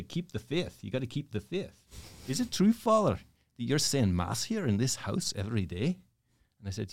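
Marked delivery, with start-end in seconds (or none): 0.0-1.8 put-on lilting, higher-pitched Northern Irish accent. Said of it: "he's from the Northern Ireland, you know, where they say". 2.3-6.0 Northern Irish lilt, jumping high at the start; Northern Irish accent. Retold or imitated. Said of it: "he's from the Northern Ireland".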